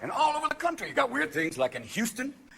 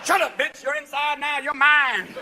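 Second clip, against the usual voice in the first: tough inmate; 9 semitones higher, 6 dB louder, slower than usual. Acted. Inmate threatening Martha Stewart.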